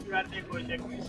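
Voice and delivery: ordinary talk, nothing marked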